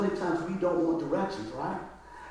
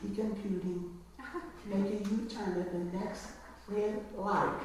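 soft, slow robotic navigation voice, lightly done; mock GPS voice; 11 dB quieter, slower than usual. Wife imitating a GPS to her husband, soft slow robotic voice. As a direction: read it like a gentle car navigator. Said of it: "I would use my voice and go".

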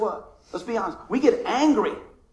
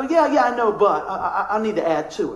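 mock dismissive; 7 dB louder than usual. Mock ungrateful gift receiver.

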